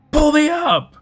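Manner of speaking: very high, loud panicked plea; near-hysterical scream. Acted. Dangling from the bridge, screaming up at Bruno in hysterics. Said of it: "I was nearing hysterics".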